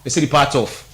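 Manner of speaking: ordinary talk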